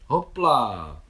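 loud joyful cry on the jump; cried out hopla. A narrator's reading. Mášenka jumping onto her small horse, joyful loud cry. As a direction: call it out as she leaps on. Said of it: "vykřikla Mášenka".

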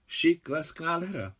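high, delighted little cry. A narrator's reading. Naďa delighted over the old raincoat, voice jumping up high. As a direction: gush over it with joy. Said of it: "zaradovala se Naďa".

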